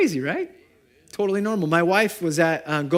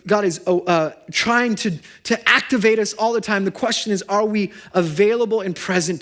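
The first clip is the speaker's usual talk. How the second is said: raised, driving sermon voice, pressing each point; fervent preaching; 6 semitones higher, 6 dB louder than usual. Acted. Pastor urging the congregation, in a raised fervent preaching voice. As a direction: preach it with full conviction to the room.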